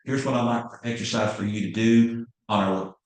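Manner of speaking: ordinary talk, nothing marked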